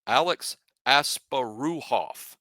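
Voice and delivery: slow Alex Asparuhoff, as-pa-ROO-hoff; careful pronunciation. Shown as a demonstration. Host gives the full name for fans, slow. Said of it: "is how that's properly pronounced".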